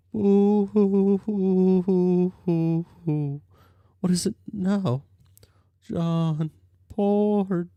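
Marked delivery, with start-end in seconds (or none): 0.1-3.4 mock ghost sobbing. Ghost Jade wails in grief.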